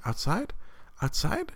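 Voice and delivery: put-on high rising little kid question; a small boy voiced high, rising twice; excited toddler. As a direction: ask it like an eager toddler. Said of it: "He was like".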